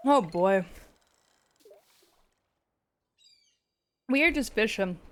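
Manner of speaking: talking through a mouthful of dinner; words around a bite of food; chewing while talking. Real, not acted. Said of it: "Sorry, I'm also eating dinner".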